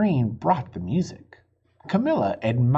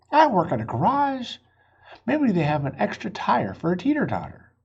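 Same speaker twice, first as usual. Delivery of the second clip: Miss Lopez voice; 7 semitones higher, 3 dB louder than usual. Acted. Miss Lopez offers help.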